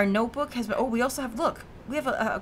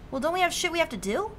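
higher complaining reply; 7 semitones higher, faster than usual. Acted. Reply to partner as player.

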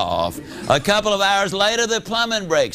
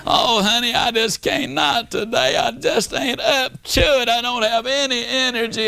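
husband pleading with his wife that he is too tired; whiny tired husband; 5 semitones higher than usual. Retold or imitated. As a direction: beg off like a worn-out husband. Husband begs off again.

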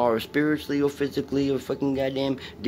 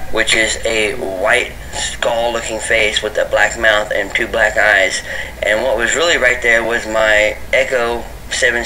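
louder explanatory narration; voiceover narration; 10 dB louder than usual. Real, not acted. Narrating the footage to viewers, louder explainer.

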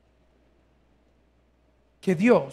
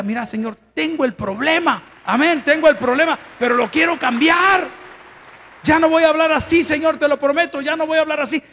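higher and louder, crying out to God; pleading prayer; 7 semitones higher, 4 dB louder than usual. Acted. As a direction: beg the Lord, rising to a shout. Acted repentant prayer to God.

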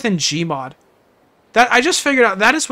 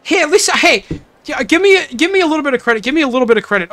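loud, high, defensive plea for credit; defensive protest; 6 semitones higher, 6 dB louder, faster than usual. Real, not acted. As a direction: beg chat for a little credit.

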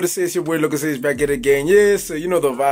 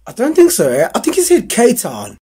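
doubting friend; 5 semitones higher, 4 dB louder than usual. Acted. Another character doubts, higher voice.

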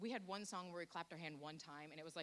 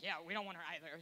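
mock Satan voice; 7 dB louder, faster than usual. Satan rejecting her too, joke.

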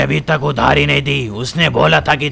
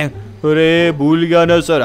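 loose, slurry thug voice with a swagger; tipsy goon; much slower than usual. Acted. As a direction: play the drunk henchman lazing about. Undertaker makes excuses. (नशे में धुत गुंडे की आवाज़)

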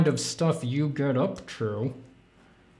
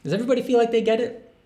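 silly high voice; 10 semitones higher, much faster than usual. Acted. Teasing chat in silly voice.

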